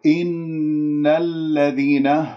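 slow, drawn-out tajweed recitation; Quran recitation. Shown as a demonstration. Teacher models the verse for students, measured recitation. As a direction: recite slowly, holding the nasal n.

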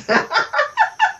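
a high amused laugh; bright pleased laugh. Real, not acted. Laughing at own boast.

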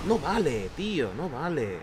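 loud, high frustrated outburst; angry protest. Real, not acted. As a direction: shout it out, fed up. Protests after dying in game.

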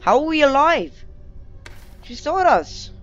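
high, loud incredulous outburst; stunned at a narrow escape, high and loud; amazed disbelief. Real, not acted. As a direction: exclaim in astonishment, pitched right up. Amazed to survive the raptor's sight.

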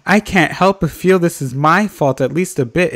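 hammed-up British lament; horrible British accent. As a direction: read it out in a badly faked British accent. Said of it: "That is a horrible accent".

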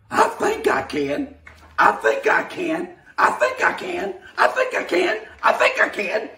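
high, loud and gathering speed; building excitement. Acted. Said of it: "said the little blue engine".

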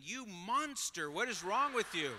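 high, loud, drawn-out accusation; hammed-up disgusted scolding; mock outrage. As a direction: imitate an appalled onlooker condemning him. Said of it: "looking at me and thinking".

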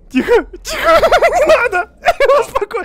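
high loud laughing yell; laughing panic. Real, not acted. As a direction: plead while laughing. Friend doing risky jump. (в панике, со смехом)

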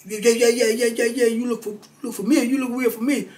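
put-on high, eager old man's voice, laughing at the end; excited old-timer. Old Cooper Lee recognizing the teller, in a high, eager voice. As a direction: play the old convict lighting up in recognition.

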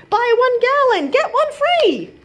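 high, bright sales pitch; salesman pitch. A narrator's reading. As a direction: sell it like a shop ad. Mock store sales pitch.

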